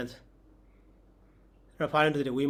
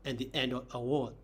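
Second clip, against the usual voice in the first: ordinary delivery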